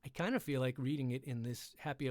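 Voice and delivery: plain reading voice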